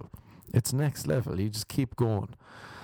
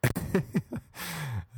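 laugh; 8 semitones higher than usual. Real, not acted.

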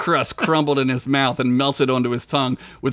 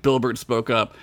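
ordinary talk, nothing marked

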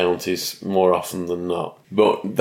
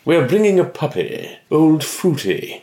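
mock RP French accent; 9 semitones higher than usual. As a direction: mimic the actor's English-sounding French accent. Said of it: "the most R P French accent I have ever heard".

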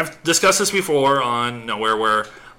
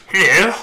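"Hello." pitched up and louder with a rise; emphatic hello; 7 semitones higher, 6 dB louder than usual. Shown as a demonstration. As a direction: lean hard into "Hello." with a lifted ending. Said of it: "I'm adding the emphasis".

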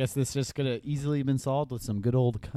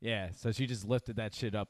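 ordinary talk

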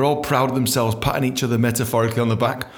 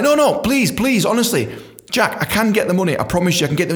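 pleading; 8 semitones higher than usual. Retold or imitated. Begging Jack.

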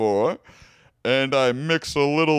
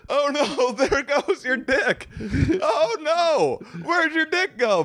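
high mock panic; 13 semitones higher than usual. Reacting to escaping dick.

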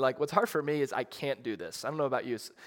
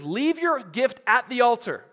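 higher, louder, slow proclamation; preaching Jesus; 10 semitones higher, 10 dB louder than usual. Retold or imitated. Jesus commanding his listeners, slow and loud. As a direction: boom it out like a command from the mount. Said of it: "Jesus says".

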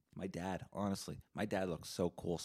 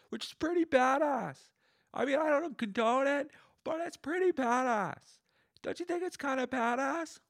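high-pitched yelling over bar noise; loud, high, strained bar yell; screaming in her ear; 5 dB louder than usual. Acted. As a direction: shout excitedly right into someone's ear. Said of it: "scream at her in her ear".

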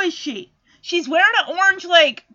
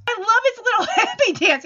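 usual speaking voice

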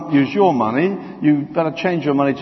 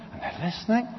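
fading hush; 4 semitones higher, 9 dB quieter, faster than usual. A narrator's reading. Soldiers spellbound listening to Jesus, hushed and fading. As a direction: let it fade to a hush.

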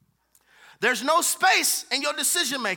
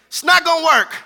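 louder, higher frustrated outburst; retold frustration; 5 semitones higher, 10 dB louder than usual. Pastor reliving his frustrated outburst, loud. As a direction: blurt it out in frustration. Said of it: "I'm just upset".

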